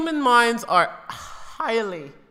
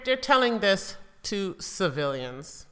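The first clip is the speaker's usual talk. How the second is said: normal talking voice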